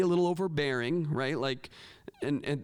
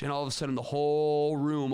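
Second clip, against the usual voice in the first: ordinary talk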